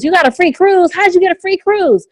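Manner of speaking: high, astonished double-take; can't believe the free cruise; amazed. Retold or imitated.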